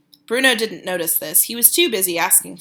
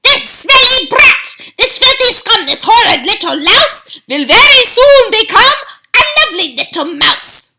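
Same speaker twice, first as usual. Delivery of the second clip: witch shouting; 13 semitones higher, 10 dB louder than usual. A narrator's reading. Grand High Witch gloating to the witches over Bruno. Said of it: "she shouted out".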